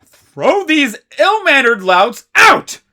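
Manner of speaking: loud, slow, high furious order; furious command. Acted. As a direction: bark the order down at them. Riddle orders them thrown out.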